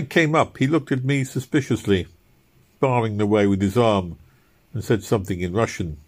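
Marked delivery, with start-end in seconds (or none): none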